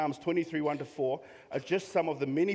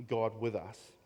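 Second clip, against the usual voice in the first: ordinary talk, nothing marked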